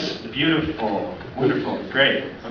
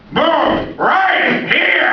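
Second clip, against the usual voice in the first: very high, slow shouted command; hammed-up Pacino yelling at the driver; Al Pacino impression; 6 dB louder, much slower than usual. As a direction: bark it like a furious Pacino. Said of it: "Be like Al Pacino".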